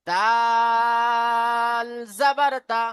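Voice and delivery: দাল জাবার দা drawn out very slowly, each sound held; slow teaching demo of দাল জাবার দা; reading demo. Shown as a demonstration. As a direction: spell it out slowly, syllable by syllable. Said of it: "এইভাবে এগুলোকে পড়তে হবে".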